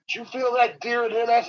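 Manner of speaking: pitched well up, startled; a high, startled cousin; cousin's alarmed question. Retold or imitated. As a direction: ask it high and rattled. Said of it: "my cousin goes".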